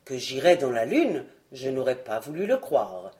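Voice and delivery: voix grave de colosse; exclamation d'un géant bonhomme, bien plus grave; colosse qui s'écrie. Acted. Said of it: "s'écria le colosse". (voix du colosse, grave et étonnée)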